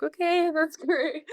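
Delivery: high, slow, laughing fake delight; mock a pleased reply with a laugh; drawn-out sarcastic okay, that's great. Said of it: "So I'm like".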